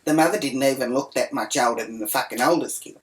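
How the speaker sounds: usual speaking voice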